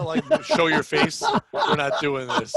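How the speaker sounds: loud, drawn-out telling-off; scolding shout. Real, not acted. Host scolding a guest, slow shout. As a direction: shout it slowly, laying down the law.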